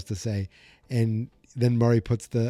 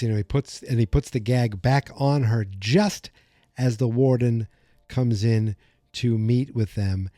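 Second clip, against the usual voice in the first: ordinary delivery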